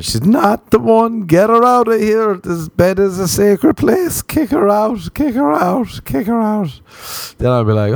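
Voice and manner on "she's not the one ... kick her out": an inner voice yelling orders; brain screaming. Pretend. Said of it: "my brain doesn't start screaming".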